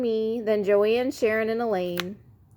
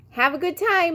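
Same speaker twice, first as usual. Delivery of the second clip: called out; 9 semitones higher than usual. Acted. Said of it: "Beverly called".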